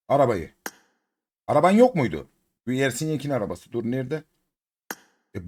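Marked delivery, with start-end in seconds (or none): none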